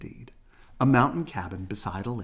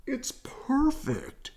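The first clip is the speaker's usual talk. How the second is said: shouting; much slower than usual. A narrator's reading. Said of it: "he shouted".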